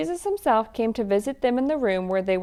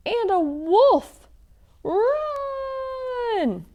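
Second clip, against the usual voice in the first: mock alarm; 6 dB louder, much slower than usual. Wolf appears in story.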